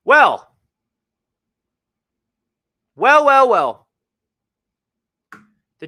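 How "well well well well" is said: booming, drawn-out well; loud dramatic. Real, not acted. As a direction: announce it like a showman. Loud dramatic opening after pause.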